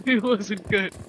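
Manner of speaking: amused, laughing verdict. Real, not acted. Fan dismissing the rest of the show, laughing. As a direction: say it slowly through laughter.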